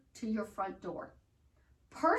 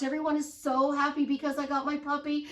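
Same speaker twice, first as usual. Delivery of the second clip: normal talking voice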